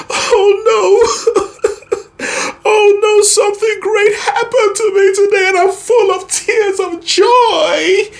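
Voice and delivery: very high-pitched, overwrought wail; hammed-up tears of joy in a very high voice; tearful joy inflection. Shown as a demonstration. Singing teacher demonstrating inflection with great news, hammed-up tearful high voice. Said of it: "with the words are vocal inflection".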